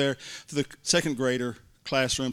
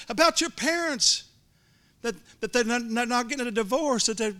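eager telling; 9 semitones higher than usual. Retold or imitated. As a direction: lean in and tell her gently excited. Tells girl the news.

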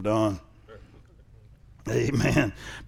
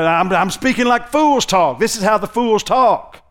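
slow, raised-pitch preacher's paraphrase; fool's talk; 7 semitones higher, 6 dB louder, slower than usual. Retold or imitated. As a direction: voice the quoted boast high and emphatic. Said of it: "acting like a fool".

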